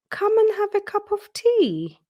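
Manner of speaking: a high sing-song offer of tea; hedgehog hostess. Acted. Hedgehog hostess invites Peter.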